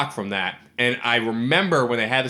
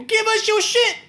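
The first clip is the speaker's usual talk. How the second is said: high mock robber; slower than usual. Robbers sticking up the bickering pair, very high mock threat. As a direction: squeak the holdup like a cartoon thug. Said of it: "They go".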